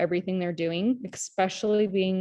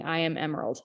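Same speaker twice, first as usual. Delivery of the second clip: normal talking voice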